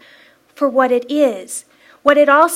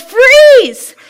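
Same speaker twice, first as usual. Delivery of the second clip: high, very loud mock-stern yell; playful full-voiced shout; yelled command; 12 semitones higher, 13 dB louder than usual. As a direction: yell it down a hallway to stop a runaway child. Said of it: "I just yelled".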